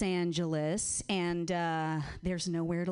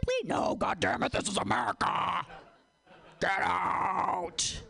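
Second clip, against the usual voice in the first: greedy hospital landlord; 6 semitones higher than usual. Retold or imitated. Hospital owner yelling at evicted artists, hammed-up shouts. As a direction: sneer and bellow at the poor tenants. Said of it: "children's hospitals are like".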